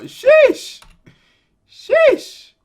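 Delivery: very high, loud squeak; squeaky sheesh. Acted. Tiny creature exclaiming in exasperation.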